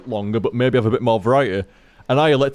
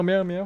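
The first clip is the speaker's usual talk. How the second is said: high, soft mock meow; imitating cat meows; 6 semitones higher, 5 dB quieter than usual. Owner copying his cat's usual meow, softly. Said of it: "Usually it's a full-on meow".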